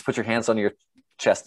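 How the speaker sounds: ordinary talk, nothing marked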